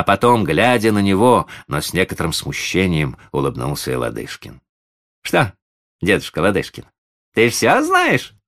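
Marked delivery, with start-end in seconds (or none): 5.2-8.3 put-on high, boyish tease; sly teasing. A narrator's reading. Boy teasing his grandfather, sly. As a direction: tease slyly, pitch leaping up. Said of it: "поддразнил его лукаво Сергей".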